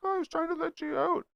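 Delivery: mocking the other driver. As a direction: whine the excuse like a lame kid. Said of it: "He's like".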